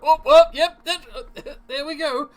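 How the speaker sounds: high, startled yelps as he's hoisted; hammed-up man being slung over a shoulder; flustered wounded man. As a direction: stammer in a high voice, half laughing. Hurt husband voiced while being lifted.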